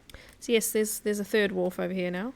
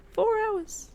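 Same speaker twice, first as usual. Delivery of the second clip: high exasperated squeak; 13 semitones higher than usual. Real, not acted. Gamer squealing at finally finding a hatchet, exasperated. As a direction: leap way up on "Four hours", incredulous.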